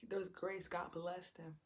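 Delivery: ordinary talk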